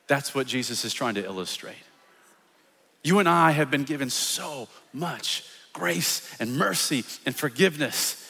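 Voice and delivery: usual speaking voice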